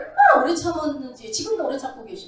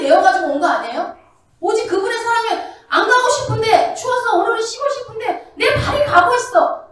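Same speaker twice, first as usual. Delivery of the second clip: loud, raised sermon climax; fervent preacher pressing the point; full-force preaching; 6 semitones higher, 7 dB louder than usual. Acted. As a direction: drive it home from the pulpit.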